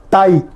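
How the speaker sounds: "tai" with the A stressed, clear oral vowel; "tai" as one beat led by a strong A. Shown as a demonstration. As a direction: lean on the A of "tai", short I after it. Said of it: "el acento le pongo en la A".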